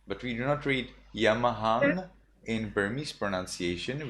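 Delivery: ordinary delivery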